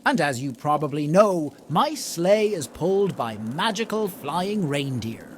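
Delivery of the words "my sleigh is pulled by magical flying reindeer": usual speaking voice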